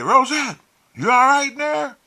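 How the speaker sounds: high, loud call into another room. Retold or imitated. Man checking on his missing companion in the restroom, calling out high and loud.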